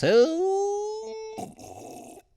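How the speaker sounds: long, very high falsetto "oh"; high hoot. Real, not acted. Host plugging his own podcast, high drawn-out hoot. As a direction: let out a sustained high hoot.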